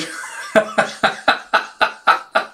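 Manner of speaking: breathy, stuttering giggle fit; helpless laughter. Real, not acted. Vlogger losing it mid-sentence, real giggle fit. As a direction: break down giggling mid-story.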